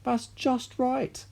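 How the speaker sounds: high, slow, put-on anxious voice; mother willing grandma to stop. A narrator's reading. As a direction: plead it slowly. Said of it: "cried Missus Cranky".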